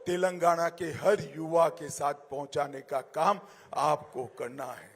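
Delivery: ordinary talk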